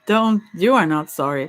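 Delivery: ordinary delivery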